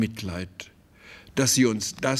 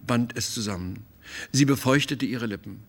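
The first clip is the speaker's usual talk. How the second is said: ordinary delivery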